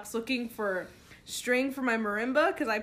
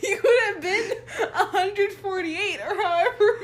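high, loud, helpless laughter in the words; laughing hard; 10 semitones higher, 6 dB louder than usual. Real, not acted. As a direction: squeal it out while cracking up. Cracking up at the age.

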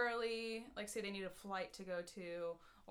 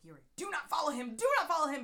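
rising, louder insistence on the repeat; urgent retold warning; 6 semitones higher, 7 dB louder than usual. Passenger begging the driver not to follow, urgent. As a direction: plead firmly, building as you repeat it.

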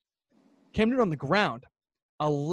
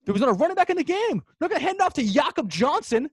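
high-pitched, worked-up insistence; heated argument; 8 semitones higher than usual. Real, not acted. Fan arguing the obvious play to co-host, worked up. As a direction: argue it at a high, exasperated pitch.

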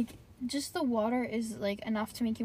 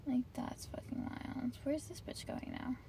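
hushed; 9 dB quieter than usual. Real, not acted. Whispering while watching a driver.

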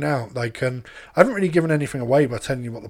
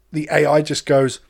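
normal talking voice

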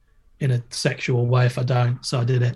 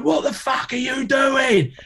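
retold angry shout; 12 semitones higher than usual. Coach figure yelling at the skater, furious shout. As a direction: bark it across the ramps. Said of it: "He's like".

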